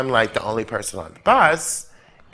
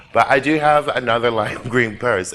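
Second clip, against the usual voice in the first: ordinary delivery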